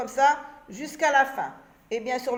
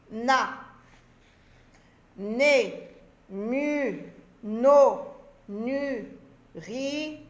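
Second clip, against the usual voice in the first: lecture syllabique; much slower than usual. Shown as a demonstration.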